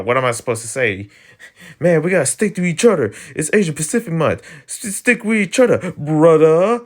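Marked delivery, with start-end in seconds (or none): 1.8-6.8 high-pitched, hammed-up brotherly appeal; over-the-top pretend bonding cry; mock solidarity plea. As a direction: plead in a put-on high voice, building to a loud last word.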